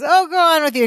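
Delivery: Balky pushing her harder, playful; mock Balky, bigger. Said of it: "then he goes".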